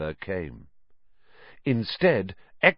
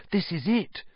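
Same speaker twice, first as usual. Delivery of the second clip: breathed excitedly; 6 semitones higher, 5 dB quieter than usual. Acted. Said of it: "he breathed".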